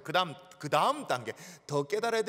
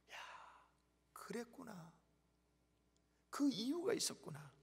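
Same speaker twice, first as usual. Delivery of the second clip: hushed realization; 9 dB quieter than usual. Acted. Believer voicing a sudden realization.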